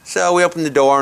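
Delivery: ordinary delivery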